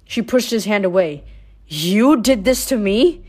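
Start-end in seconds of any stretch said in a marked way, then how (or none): 1.7-3.2 slow, loud accusing demand; a girl confronting her father, demanding. A narrator's reading. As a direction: demand it slowly, stunned and furious. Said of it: "she demanded".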